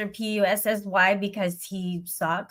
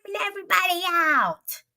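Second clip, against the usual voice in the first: mocking accuser; 10 semitones higher than usual. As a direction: imitate an accuser calling out in a high voice. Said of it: "wanted to go up there and say".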